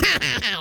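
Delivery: low, gloating pirate laugh; villainous laugh. Acted. Pirate villain gloats over thieves.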